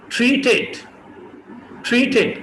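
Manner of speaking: ट्रीटेड with puffs on both t; aspirated t. Shown as a demonstration. Teacher models ट्रीटेड aspirated. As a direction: say ट्रीटेड as thrithed. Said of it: "दोनों aspirate होंगे".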